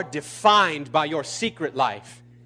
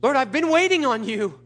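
high, exasperated protest to God; re-enacted impatient prayer; whining complaint; 8 semitones higher than usual. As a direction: whine upward as an aggrieved plea.

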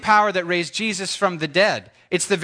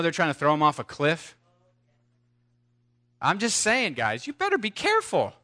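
ordinary talk, nothing marked

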